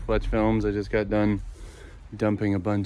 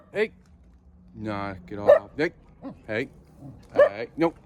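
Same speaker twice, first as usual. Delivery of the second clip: scolding the dogs. Real, not acted. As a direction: tell the dogs off, cut it out now. Scolding dogs mid tour.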